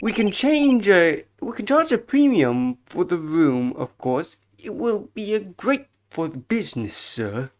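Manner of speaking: obsequious bellboy. Acted. Bellboy boasting of the hotel's reputation, eager servile voice. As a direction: savour the hotel's coming fame, pitch swooping.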